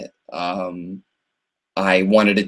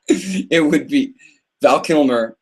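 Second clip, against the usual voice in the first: laughing; 4 semitones higher, slower than usual. Real, not acted. Laughing at his own joke.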